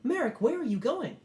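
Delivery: high, firm machine voice; robot guards. Acted. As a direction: stop him from leaving, firmly. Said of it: "And they go".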